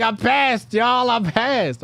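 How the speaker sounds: high, loud victory yell; celebrating shout. Retold or imitated. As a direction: announce it to the whole room. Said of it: "Why are you making so much noise".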